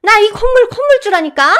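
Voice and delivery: mother-in-law screaming she owns the building; screaming rage. Acted. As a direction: sputter then scream at him. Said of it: "소리를 고래고래 질러서".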